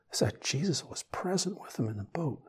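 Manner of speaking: normal talking voice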